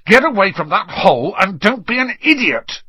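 exploding angry order; high-pitched outburst of temper; Ron. A narrator's reading. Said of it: "Ron exploded".

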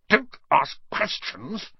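slow high sharp retort; snapping. A narrator's reading. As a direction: snap at him. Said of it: "snapped his uncle".

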